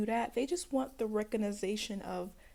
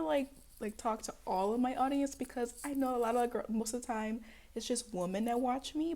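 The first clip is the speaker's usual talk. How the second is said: ordinary talk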